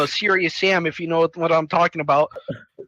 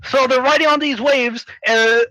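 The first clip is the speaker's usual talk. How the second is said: ordinary delivery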